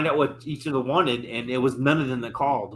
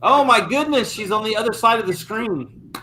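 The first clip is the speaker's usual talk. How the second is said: high, astonished exclamation; real surprise; 8 semitones higher than usual. Real, not acted. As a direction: exclaim it high, then ease down slightly. Reacting to someone suddenly appearing elsewhere.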